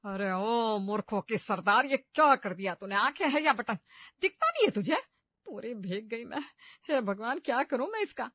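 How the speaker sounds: loud, high shrieking tirade at the clumsy bride; furious yelling. Acted. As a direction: scream at her, soaked and outraged. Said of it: "Pinky पर चिल्लाती है".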